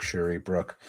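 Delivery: ordinary talk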